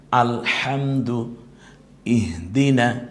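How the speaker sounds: আলহামদু with the হ pressed in the throat, slow; throat-pressed ha in recitation. Shown as a demonstration. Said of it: "গলায় চাপ দিয়ে". (গলায় চাপ দিয়ে আলহামদু)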